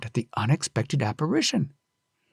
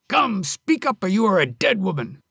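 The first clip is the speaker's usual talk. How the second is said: threatening husband; 6 semitones higher than usual. Acted. Husband threatening Onami with death, angry. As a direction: threaten her life.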